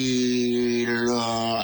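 drawn out very slowly, far away; slow-motion calm. Retold or imitated. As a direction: stretch it like time stopped. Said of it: "así, así lo sentía". (muy lento, como en cámara lenta)

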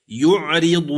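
يعرض recited with a thin, light ر; Quranic recitation. Shown as a demonstration. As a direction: chant يعرض slowly with a softened ر. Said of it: "وإليك بيان عملي للراء المكسورة".

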